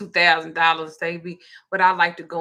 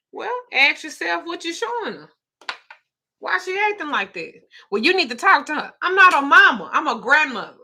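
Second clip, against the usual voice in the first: scolding daughter; 8 semitones higher than usual. Retold or imitated. Retelling lecture to her daughter.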